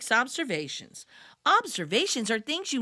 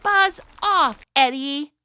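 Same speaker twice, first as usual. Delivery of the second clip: angry shout; 3 semitones higher, 7 dB louder than usual. Acted. Yara yelling at the teasing boy. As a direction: snap at the pest to leave.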